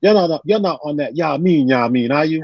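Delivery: mock an old head checking on you; imitating Mike Lisbon. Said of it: "He goes".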